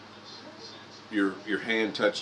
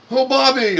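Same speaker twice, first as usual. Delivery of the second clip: much higher and louder, overjoyed hello; dad's loud greeting; 13 dB louder than usual. Retold or imitated. Dad greeting Bobby Knight at hospital, loud and overfamiliar. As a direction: greet him like a lifelong friend. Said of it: "my dad was like".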